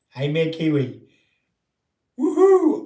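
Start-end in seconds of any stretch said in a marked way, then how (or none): 2.2-2.8 high, loud woohoo of real glee; a thrilled whoop about the coming trip; excited whoop. Real, not acted. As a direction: let out a happy cheer. Cheering about the trip south.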